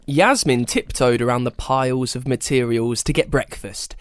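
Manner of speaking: plain reading voice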